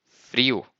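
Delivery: Frio said in Portuguese, a rolled r, ending in u; slow model word Frio, the teacher's way. Shown as a demonstration. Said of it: "you say frio".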